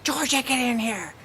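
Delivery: put-on high yelling call to a child; mock scream at the kids; imitated scream. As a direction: shriek it like an angry mom. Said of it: "Jennifer screaming at her kids".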